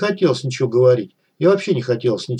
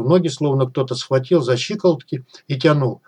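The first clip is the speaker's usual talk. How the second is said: ordinary delivery, nothing marked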